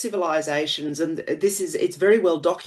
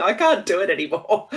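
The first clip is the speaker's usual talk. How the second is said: said through a laugh; 3 semitones higher than usual. Real, not acted. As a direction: laugh while giving up on the accent. Laughs over failed accent.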